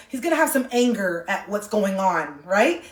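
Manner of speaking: normal talking voice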